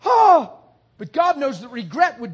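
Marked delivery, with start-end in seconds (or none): 0.0-0.6 anguished cry. Retold or imitated. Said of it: "And now they're going".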